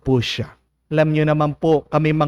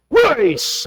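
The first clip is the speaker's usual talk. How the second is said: forceful exclamation; 10 semitones higher than usual. Acted. Priest forcing communion angrily.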